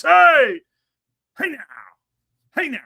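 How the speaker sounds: big loud shout of greeting; excited shout. Real, not acted. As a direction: yell out a happy hello. Greeting a friend in chat.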